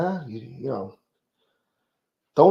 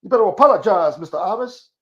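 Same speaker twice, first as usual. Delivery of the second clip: high, loud, scolding Reverend Al impression; outraged reverend scolding a joker; scolding preacher; 8 semitones higher, 8 dB louder than usual. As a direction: chew him out like an angry preacher. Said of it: "he probably, uh, be like".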